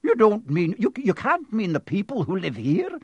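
high, disbelieving outburst; shocked cry. A narrator's reading. McGonagall realising who Dumbledore means, crying out in shock. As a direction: cry out in dismay, stumbling on "you". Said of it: "cried Professor McGonagall".

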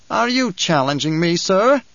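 rising sharply on sir; a mild menacing challenge. Acted. Kynes challenges the banker.

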